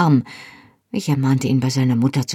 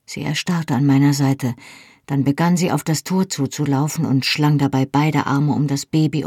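plain reading voice

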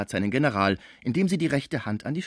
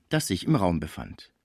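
ordinary delivery

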